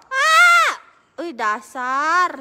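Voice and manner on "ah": sharp high yell of panic; panicked shout. Real, not acted. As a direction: cry out as your hero dies. Getting killed in the game. (teriakan panik tinggi)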